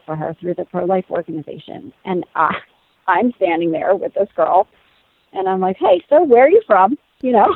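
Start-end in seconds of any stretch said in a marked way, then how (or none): 5.8-7.0 bright forced cheer. Retold or imitated. Chatty greeting to the girl.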